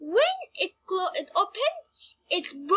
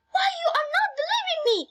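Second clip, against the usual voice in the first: high, fast indignant protest; Valandora; 13 semitones higher, much faster than usual. A narrator's reading. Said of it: "Valandora told him".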